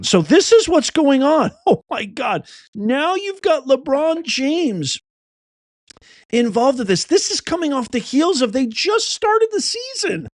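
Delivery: high-pitched, exclaiming in disbelief; incredulous outburst. Real, not acted. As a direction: react as if you can't believe this news. Host reacts to breaking scandal.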